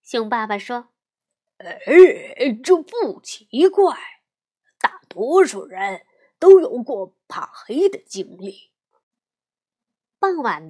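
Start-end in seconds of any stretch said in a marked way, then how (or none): 1.6-8.7 lightly voiced patient bear dad; papa bear voice. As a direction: explain like a kind father. Papa reassures.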